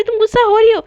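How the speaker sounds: high, loud contradiction; insistent accusation. Acted. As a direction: throw it back at them firmly. Acted accusation in a quarrel.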